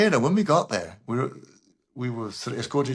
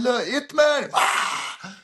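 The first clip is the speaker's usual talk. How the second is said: high, pitched-up call to the crowd; mock announcement; 13 semitones higher, 3 dB louder, much slower than usual. Host announcing Hitman to a screaming crowd: high, excited call. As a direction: belt it out like an MC introducing the game.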